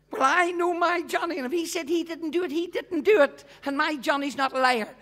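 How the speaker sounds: teacher imitating the stubborn mother; mimicking mother. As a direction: parrot the mother's defiance.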